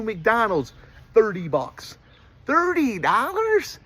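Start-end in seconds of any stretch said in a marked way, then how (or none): 2.5-3.8 high, stunned question on dollars; can't believe the price; outraged disbelief. Real, not acted. Shocked at a thirty-dollar McDonald's bill, high disbelief.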